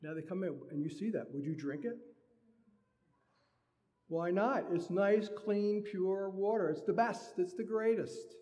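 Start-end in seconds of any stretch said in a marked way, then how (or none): 4.1-8.3 louder, higher, selling it hard; hammed-up insistence that it's great; mock enthusiasm. Preacher mocking the host's sales pitch, loud and high. Mock defending the dirty glass.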